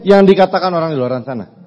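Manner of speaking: rally the crowd to hold on; preaching. Acted. Motivational climax over music. (berkhotbah penuh semangat)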